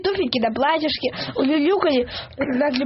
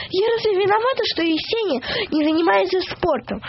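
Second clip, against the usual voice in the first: crying out; 6 semitones higher than usual. A narrator's reading. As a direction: protest as a mild mock yell, pitched up. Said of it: "крикнула попа". (возмущённый возглас, высоко, но негромко)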